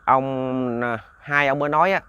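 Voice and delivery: ordinary delivery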